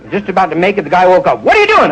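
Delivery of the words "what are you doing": much higher mock yelp; startled roommate. Roommate catches the guy at the sink, high yelp. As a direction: yelp it in sudden alarm.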